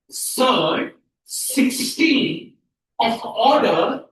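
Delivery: very slow, drawn-out words; slow model reading. Shown as a demonstration. Teacher shows how it is pronounced, slowly. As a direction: stretch each term for the class. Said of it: "क्या pronounce करेंगे".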